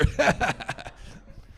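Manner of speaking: unvoiced laugh after the punchline; a short unvoiced chuckle; breathy laugh. Real, not acted. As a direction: laugh at own joke, with no voice.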